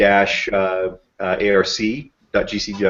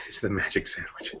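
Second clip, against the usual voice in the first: whispered aside; 12 dB quieter than usual. Real, not acted. Muttered aside on seeing icon.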